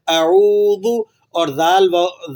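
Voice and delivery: slow, drawn-out اعوذ with the long واو; stretched recitation. Shown as a demonstration. Teacher recites slowly to students. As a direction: stretch اعوذ for one alif length. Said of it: "کھینچ کر پڑھا جائے گا".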